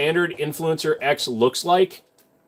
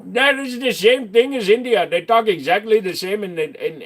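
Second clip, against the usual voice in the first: put-on Indian accent; 7 semitones higher than usual. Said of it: "your Indian accent turned out almost Scottish".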